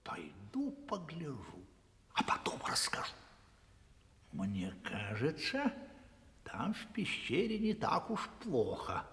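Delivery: whispered, scheming softly; wild dog plotting to creep to the cave; sneaky whisper. Acted. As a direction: whisper it as a secret plan. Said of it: "и дико сказал".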